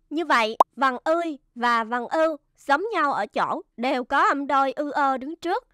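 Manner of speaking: usual speaking voice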